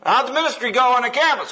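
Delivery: high, bright-pitched greeting; wife greeting husband at the door, pitched high; mock cheery wife. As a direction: chirp hi like an eager wife. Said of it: "she says".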